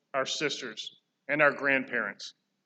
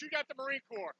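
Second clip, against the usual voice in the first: usual speaking voice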